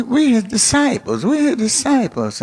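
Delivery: high-pitched protesting disciples; mock indignant claim, high; self-important insistence. Voices disciples asserting their status.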